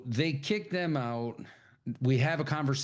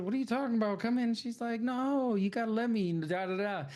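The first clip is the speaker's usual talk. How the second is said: mock high retold quarrel; high mock voices; 11 semitones higher than usual. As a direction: pitch jumps high through the exchange. Cornbread and Mary quoted.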